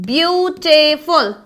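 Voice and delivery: very slow "beautiful", split beau-ti-ful; syllable by syllable. Shown as a demonstration. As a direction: stretch "beautiful" into three separate beats.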